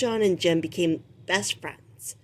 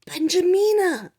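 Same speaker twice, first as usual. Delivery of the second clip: much higher, louder call; crying out her name; 11 semitones higher, 5 dB louder than usual. Acted. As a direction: shout her name in recognition. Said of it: "the captain cried".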